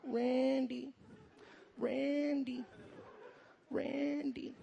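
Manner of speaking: soft, drawn-out calling of a name; voice of a girlfriend. Acted. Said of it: "What does she always say?".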